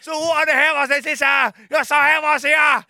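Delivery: high, loud gushing TV-host voice; overexcited makeover host. Retold or imitated. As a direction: sell the horse room like a hyped TV presenter. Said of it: "No hei!".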